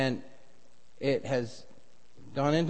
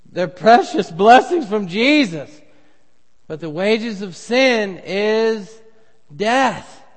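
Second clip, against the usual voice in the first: forceful preaching; 6 semitones higher, 9 dB louder, slower than usual. Acted. Preaching climax on sin.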